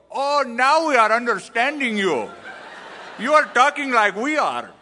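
high, loud mock Indian English; Indian accent. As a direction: imitate delighted Indian listeners in their accent. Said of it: "they would go".

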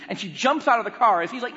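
normal talking voice

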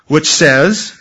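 ordinary talk

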